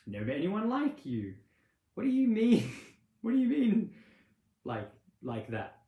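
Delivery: high, loud mock fascination; hammed-up disbelief, leaning in; intrigued date. Date reacting with fascinated surprise.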